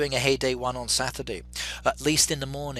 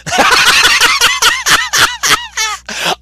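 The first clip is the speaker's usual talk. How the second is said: loud high burst of laughter; big delighted cackle at the result; laughing; 13 dB louder than usual. Real, not acted. Poll result amuses him.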